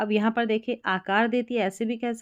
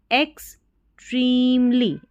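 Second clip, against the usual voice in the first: extremely stretched out, higher and louder; slow model reading; 4 semitones higher, 5 dB louder, much slower than usual. Shown as a demonstration. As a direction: draw out extremely for the class. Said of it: "ये बड़ी spelling जैसे यहाँ पर".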